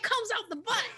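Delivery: high-pitched mock blurting; imitated outburst. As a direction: blurt it out, imitating an overexcited friend. Quoting co-host's sudden outburst.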